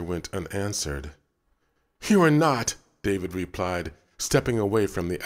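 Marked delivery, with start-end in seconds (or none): 2.1-2.7 put-on indignant child. A narrator's reading. David contradicts the other boy.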